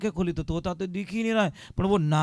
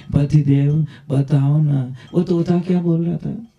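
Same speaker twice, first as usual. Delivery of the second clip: lovingly coaxing; 9 dB louder than usual. Acted. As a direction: draw the question out tenderly to coax him. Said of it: "प्रेम से बोली".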